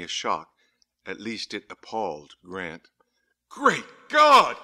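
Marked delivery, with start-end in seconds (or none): 3.5-4.6 high, loud cry of horror; shocked exclamation. A narrator's reading. Rector seeing the corpse in the opened tomb, high shocked shout. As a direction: cry out, aghast. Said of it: "he exclaimed".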